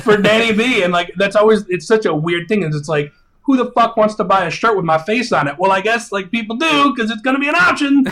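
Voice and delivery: high-pitched, animated excitement; excited. Real, not acted. Musician marvelling that people would buy his merch, excited. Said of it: "You sound so excited about it".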